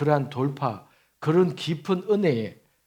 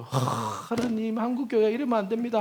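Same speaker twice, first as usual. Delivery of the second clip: imitating fervent repentant prayer; 7 semitones higher than usual. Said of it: "저도 그렇게 막 회개했어요".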